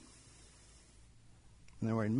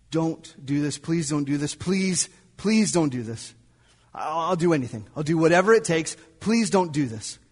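louder, pleading over and over to a gunman; desperate begging; 4 semitones higher, 8 dB louder than usual. Retold or imitated. Father begging the gunman to spare his son, pleading. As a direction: beg for your son's life, voice rising. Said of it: "I do everything I can to beg him not to".

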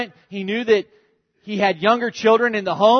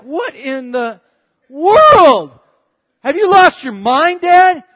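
much higher, louder shocked outburst; retold sisters shocked, higher and louder; disgusted disbelief; 10 semitones higher, 10 dB louder, slower than usual. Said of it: "they're looking at it like".

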